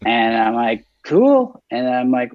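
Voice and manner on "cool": high excited "cool". Retold or imitated. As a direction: pop it out, delighted. Self quoted, high reply.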